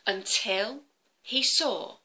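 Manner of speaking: ordinary delivery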